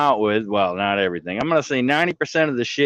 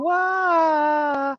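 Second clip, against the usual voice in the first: mock wail. Mock cry.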